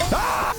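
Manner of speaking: short high shout; panicked scream. Real, not acted. Player searching for enemies, panicked scream. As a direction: yelp high in sudden alarm.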